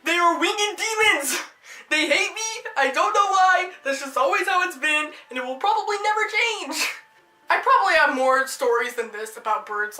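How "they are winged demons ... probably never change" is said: higher-pitched, hammed-up indignation; melodramatic grievance. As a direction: rant as if persecuted by demons. Melodramatic conclusion about birds.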